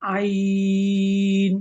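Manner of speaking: "আইন" held very long and steady; three alif stretch. Shown as a demonstration. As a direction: draw আইন out to three alifs. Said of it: "তিন আলিফ টেনে".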